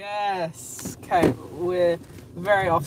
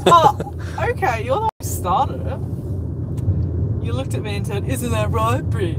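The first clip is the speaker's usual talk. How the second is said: mock protest; 4 semitones higher, 6 dB louder than usual. Real, not acted. Playful argument over chat.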